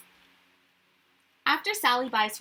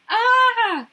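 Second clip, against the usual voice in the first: sharp high shocked shriek, much louder; shocked cry; 13 semitones higher, 13 dB louder than usual. Acted. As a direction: shriek at the sight of fried eggs. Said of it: "she cries".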